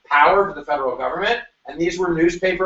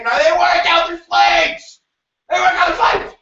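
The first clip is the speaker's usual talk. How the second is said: high, loud mock outrage; mock outraged shout; 11 semitones higher, 3 dB louder than usual. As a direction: shout it like a hammed-up protest. Mock outrage over counting slaves.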